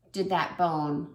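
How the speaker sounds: ordinary talk, nothing marked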